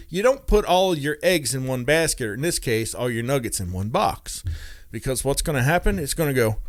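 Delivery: ordinary talk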